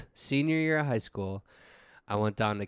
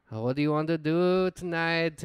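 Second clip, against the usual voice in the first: slightly higher, mock Mexican accent; put-on Mexican accent; 4 semitones higher than usual. Drunk tourist asking his buddy about tonight, mock Mexican accent. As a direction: ask it in a playful imitated Mexican accent. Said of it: "with a Mexican accent".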